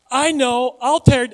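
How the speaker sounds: high, loud mock eureka; hammed-up sudden inspiration; not-so-brilliant schemer. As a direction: lightly play a fool with a big idea. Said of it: "this guy's not really that brilliant".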